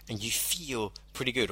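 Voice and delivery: soft hypnotic emphasis on feel pretty good; emphasized phrase. Shown as a demonstration. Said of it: "where I'm emphasizing the words".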